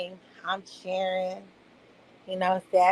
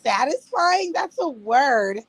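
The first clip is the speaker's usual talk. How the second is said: high, loud disbelieving protest; a mother indignant her daughter bans a word, loud and high; indignant surprise; 3 semitones higher, 4 dB louder, slower than usual. Retold or imitated. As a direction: push back, offended and amazed. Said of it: "I'm like".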